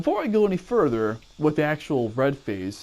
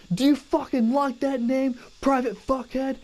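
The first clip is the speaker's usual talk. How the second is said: drill sergeant; 10 semitones higher than usual. Retold or imitated. Drill sergeant yelling at recruit.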